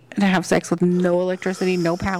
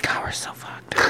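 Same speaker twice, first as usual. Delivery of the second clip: whisper; 10 dB quieter than usual. Real, not acted. Dread over collapse.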